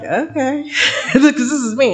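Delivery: high, giggling okay; laughing okay. Retold or imitated. Customer laughing at the rude clerk, high. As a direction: laugh it out, amused. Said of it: "And you're like".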